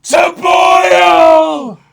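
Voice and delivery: very high, very loud cry; dramatic shout. Acted. Fan acting out Odin's last stand, shouting high. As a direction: yell it like a battle cry.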